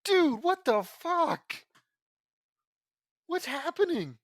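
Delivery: high, loud, drawn-out astonishment; stunned, high-pitched outburst; startled disbelief. Real, not acted. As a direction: cry out in baffled shock. Startled that Woody appears in the chapter.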